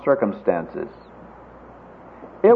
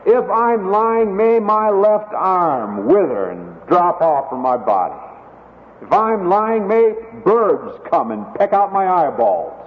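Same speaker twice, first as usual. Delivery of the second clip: higher, emphatic self-curse; sworn curse; 5 semitones higher than usual. Retold or imitated. Peter cursing himself if he is lying, emphatic sworn curse. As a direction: call the curse down on yourself, pitched up and weighty. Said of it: "It's that kind of a curse, a horrible thing".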